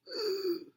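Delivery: a sharp surprised gasp. Acted. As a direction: gasp in mock shock at the poison. Teacher reacts to deadly frog.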